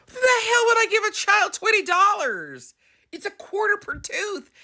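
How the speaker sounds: very high-pitched mock outrage; indignant mother. Grandmother denying she gave the money, indignant high voice.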